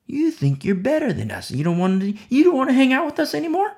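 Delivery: high, fast sneering taunt; mocking taunt. Old friends taunting the new believers, high, fast and sneering. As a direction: needle them, rising and fast.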